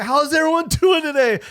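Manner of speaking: high, loud hello to a full house; stage greeting. Retold or imitated. Comic opens cold to the packed house, high loud stage greeting. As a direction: pitch it way up and project to the crowd. Repeats his opening greeting to the crowd.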